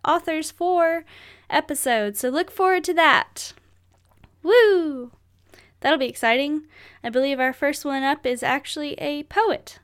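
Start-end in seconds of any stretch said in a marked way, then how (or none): 4.4-5.1 high, loud cheering "woo"; excited whoop. Real, not acted. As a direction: let out a happy whoop. Cheering upcoming author features.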